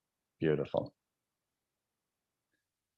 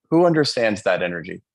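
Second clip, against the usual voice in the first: ordinary talk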